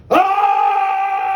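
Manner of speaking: full-throated battle cry; victory roar. Acted. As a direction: arms up, bellow the warrior's triumph. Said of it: "he kinda raises his arms, and he goes".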